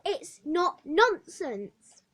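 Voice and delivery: slow, loud, defiant shout; child shouting back at the matron. Acted. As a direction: hammer out each word in protest. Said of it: "I shouted".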